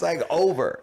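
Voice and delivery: ordinary talk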